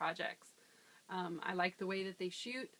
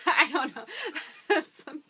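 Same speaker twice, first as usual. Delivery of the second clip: bursting into high laughter; real giggling after the joke; laughing; 7 semitones higher, 10 dB louder than usual. Real, not acted. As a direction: laugh through the words, much louder. Laughs after her accent demo.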